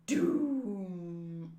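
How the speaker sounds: low, drawn-out mock doom; slow doom voice. Reader voices doom after the mole transformation, slow low drone. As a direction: stretch it out low and ominous. Slow character or dramatic effect.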